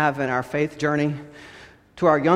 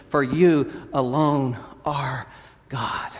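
proclaiming prayer; 3 semitones higher, 3 dB louder than usual. Acted. Proclaiming praise then soft close.

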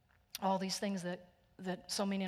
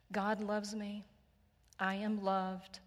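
ordinary talk

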